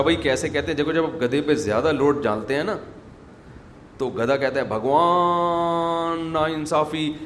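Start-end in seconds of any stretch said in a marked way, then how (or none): 4.6-7.1 slow drawn-out braying complaint; donkey voice. Pretend. Joke teller voicing the donkey, slow braying. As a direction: bray the words like an overloaded donkey.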